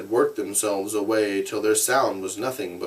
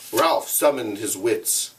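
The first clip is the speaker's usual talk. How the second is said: ordinary delivery, nothing marked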